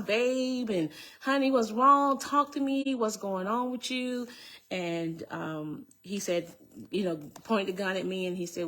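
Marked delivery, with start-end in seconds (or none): 0.0-4.3 higher-pitched coaxing of a husband; retold pleading. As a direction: re-enact pleading with him, voice raised in pitch.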